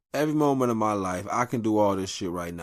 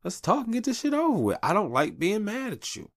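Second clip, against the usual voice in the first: higher, louder earnest plea; urgent please-let's-fix-this voice; pleading with wife; 12 semitones higher, 4 dB louder than usual. Retold or imitated. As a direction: coax her into making up. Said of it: "So I tell your wife".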